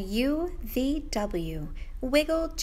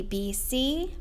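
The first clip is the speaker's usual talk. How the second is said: usual speaking voice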